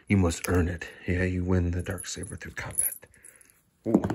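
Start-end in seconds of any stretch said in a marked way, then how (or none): none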